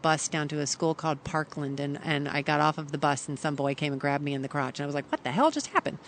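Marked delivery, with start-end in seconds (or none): 5.1-6.0 high-pitched incredulous question; mock-shocked, not shouted; retold disbelief. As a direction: replay a startled reaction, pitch jumping up. Acting own shocked reaction as child.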